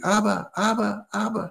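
put-on high-pitched small child calling; little kid. Little kid trying to catch up with his dad, childlike calling. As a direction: imitate a child calling for daddy. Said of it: "this little kid's trying to catch up with his dad".

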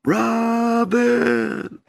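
high, drawn-out call of the name; distant call. Shown as a demonstration. Telling the Lord how the call sounded, high and drawn out. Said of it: "it sounded like this".

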